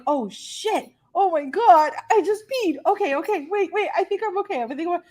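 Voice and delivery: high, rushed panic to herself; flustered panic. Retold or imitated. Mom realizing she peed mid-swab, panicking high and flustered. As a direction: scramble to reassure yourself you're okay. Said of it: "and I'm like".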